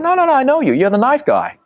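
very high, loud mock American; big American accent. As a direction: imitate an insistent American, voice shooting up. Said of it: "in a big American accent".

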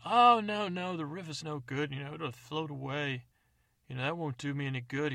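put-on a greedy old codger eager for the carcass; old man. Old man objects to the river.

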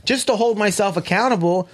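high-pitched, worked-up confession; heated admission. Real, not acted. Heatedly owns up to his temper.

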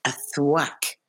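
measured read of stage directions; calm stage-direction read; script stage directions. Acted. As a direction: set the scene like a script reader.

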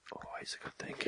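unvoiced whispered aside. Real, not acted. Aside to Dan, whispered. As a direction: whisper it under your breath. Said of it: "whispered aside to Dan".

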